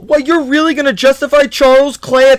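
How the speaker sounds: high, loud disbelief; you can't be serious, loud; incredulous outburst. Real, not acted. Arguing over a player pick.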